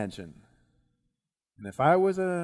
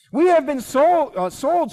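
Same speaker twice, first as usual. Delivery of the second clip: loud, high outcry; crying out; 11 semitones higher, 9 dB louder than usual. Acted. Queen denouncing the plot to the king, crying out loudly. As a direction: cry out in protest at full voice. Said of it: "she cried".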